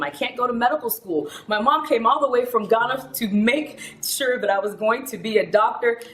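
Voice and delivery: mock desperation, tumbling into stammered repeats; retold tearful panic. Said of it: "Why are you crying?".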